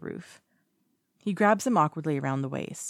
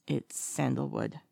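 softer, slow, a little strained; steadying a flustered voice; quietly flustered; almost normal voice; 3 semitones lower, 5 dB quieter, slower than usual. A narrator's reading. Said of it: "almost a normal voice".

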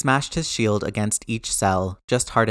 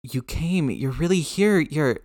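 rasped, overcome; 6 semitones higher than usual. Acted. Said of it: "Peter rasped".

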